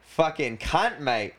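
playful fake Australian slang; mock Australian accent. Asked by his co-host to redo the Australian accent. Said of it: "You should do that accent again".

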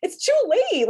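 high, loud fed-up cry; indignant heckle at the screen; exasperated viewer. Retold or imitated. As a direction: shout down the character, pitch way up. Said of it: "I'm like".

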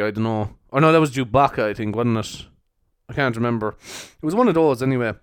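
normal talking voice

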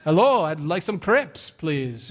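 comic mock-French tourist; bad French accent. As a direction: fake a French accent on English. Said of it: "put an accent on English".

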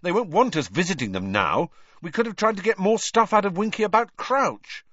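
put-on a boy complaining to Hermione; mild irritation; grumbling Ron. A narrator's reading.

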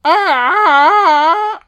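a sudden high bird squawk, mocking; squawky bird cry. Watching the film, he mocks the bird with a high squawk. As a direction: screech like the squawky bird on screen. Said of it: "there's a squawky ass bird".

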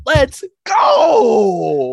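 high and loud, a shouted whoop; hyped cheer. Real, not acted. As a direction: celebrate the pick. Hyped at friend's matching pick.

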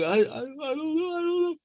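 put-on very high crying stammer of a caught boy; panicked stammer. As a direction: whimper it out, flustered. Caught kid stammering, voiced.

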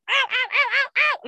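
high squealed ow ow ow; mock pained ows. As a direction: squeak out each ow like the shot hurts. Steroid shot pain retold.

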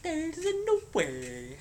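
high, slow Mario voice; mock Mario accent; Mario impression. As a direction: draw it out like Mario. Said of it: "It's like".

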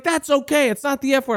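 high-pitched mock retort; heated retort. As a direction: imitate an indignant woman brushing it off. Acted woman answering in heated argument.

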